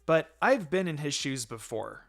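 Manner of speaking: ordinary delivery, nothing marked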